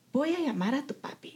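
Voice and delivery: soft, subdued handover; retold meek retreat. Mother-in-law handing her son over to his father, softly. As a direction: back away quietly, voicing someone else.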